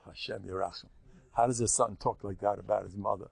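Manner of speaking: ordinary delivery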